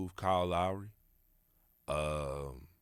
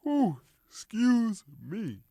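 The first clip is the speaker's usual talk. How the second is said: a long high yawn through the words; 14 semitones higher, 6 dB louder, slower than usual. Real, not acted. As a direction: yawn out ooh, excuse me. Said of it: "I'm sorry for yawning".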